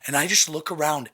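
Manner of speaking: ordinary delivery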